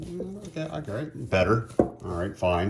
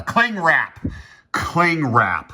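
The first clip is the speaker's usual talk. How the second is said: relieved shout; 9 semitones higher, 5 dB louder, much slower than usual. Real, not acted. Finally remembers the forgotten word.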